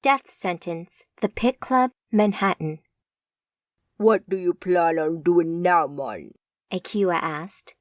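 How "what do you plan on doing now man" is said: put-on contemptuous Jamaican accent. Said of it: "contempt in his Jamaican accented voice".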